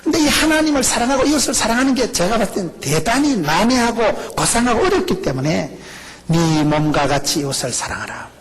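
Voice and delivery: ordinary delivery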